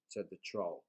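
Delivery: usual speaking voice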